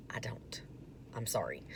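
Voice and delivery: guilty little whisper; whispered confession. Real, not acted. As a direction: breathe it out like a secret confession. Confessing can't cook soup beans.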